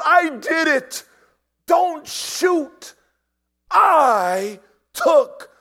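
very high, loud confession; desperate shout. Retold or imitated. Prisoner confessing to save his friends, shouting. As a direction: cry out to halt the firing. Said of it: "began to shout".

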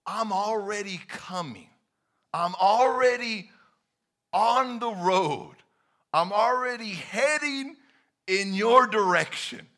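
building promise; emphatic Jesus voice. Pretend. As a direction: press on already. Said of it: "Jesus is saying".

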